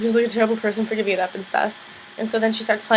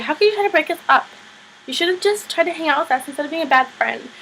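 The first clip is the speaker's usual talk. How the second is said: mock scolding; 6 semitones higher than usual. [name] scolding Tristan.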